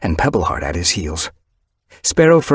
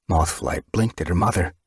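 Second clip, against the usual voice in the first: ordinary delivery, nothing marked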